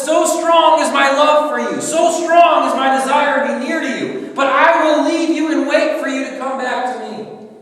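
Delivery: loud, high, impassioned plea as God; God begging his people, torn but resolved; God pleading. Acted. God pleading with his people, loud and impassioned. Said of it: "God says".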